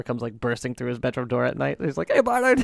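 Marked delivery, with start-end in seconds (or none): 2.1-2.6 high mock yell of a crazed dad. Dad bursts into son's room with chainsaw, high mock yell. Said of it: "he's yelling".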